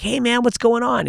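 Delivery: high, chummy hey man; mock friendly greeting. Greeting Papa Shango, high mock friendly. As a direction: greet him like an eager fan. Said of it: "I'm like".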